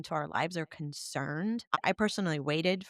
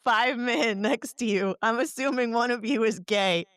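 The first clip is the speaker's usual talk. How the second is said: laughing; 5 semitones higher, 6 dB louder than usual. Real, not acted. Laughing joke about gym.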